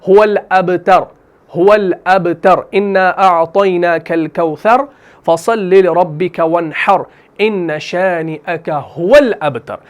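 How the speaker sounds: slow, drawn-out tajweed recitation; Quran recitation. Shown as a demonstration. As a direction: chant the verse slowly. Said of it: "surah recited as example".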